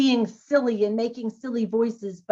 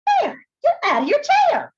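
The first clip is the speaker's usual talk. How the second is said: much higher, louder hare voice; shouting hare; 6 dB louder than usual. Acted. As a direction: yell at the lazy bear.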